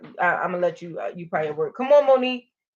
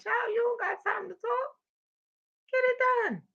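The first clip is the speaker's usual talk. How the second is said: high sassy mock scold; 13 semitones higher, 4 dB quieter than usual. Host playfully scolds a chatty viewer in a high sassy voice. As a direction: pitch it way up and sass the viewer.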